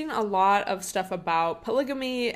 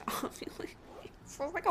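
laughing whisper; 10 semitones higher, 8 dB quieter, faster than usual. Real, not acted. Aside about baboon ovulation, laughing.

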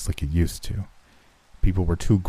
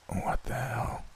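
very soft, higher-pitched groggy murmur; weak grunt; 6 semitones higher, 11 dB quieter, slower than usual. A narrator's reading. Injured man coming to before a paramedic, weak grunted words. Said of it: "When I grunted out a".

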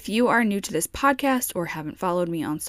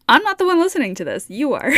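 emphatic; 7 semitones higher, 7 dB louder, faster than usual. Real, not acted. Emphatic playful aside to listeners.

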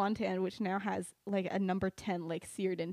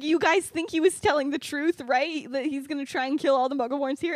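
raised, louder urgent questioning; acted urgency; 6 semitones higher, 8 dB louder than usual. Lily checking if the threat is real, acted urgency. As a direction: press them, pitched up and forceful.

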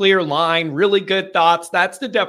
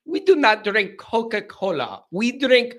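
posh mock accent; 4 semitones higher than usual. Host mocks rich caller with fancy accent. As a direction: ham up an unknown fancy accent. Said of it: "I don't know what accent that is".

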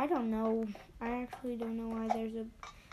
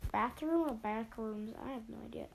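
usual speaking voice

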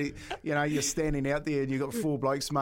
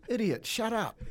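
higher-pitched retold put-down; mock irritation; 6 semitones higher than usual. Former player retelling his retort to a ball-hungry teammate, mock irritation. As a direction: dismiss him with mock exasperation.

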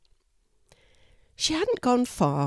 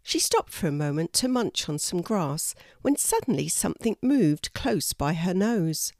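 ordinary delivery, nothing marked

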